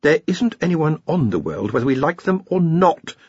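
high-voiced, mildly weary reply; a touch of exasperated fatigue. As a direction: explain with tired patience. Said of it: "said Ponder wearily".

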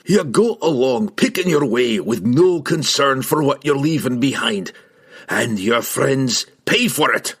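hard, loud scolding dwarf voice; angry dwarf. A narrator's reading. Dwarf berating the halfling for abandoning friends, angry. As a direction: rebuke him, pressing each word.

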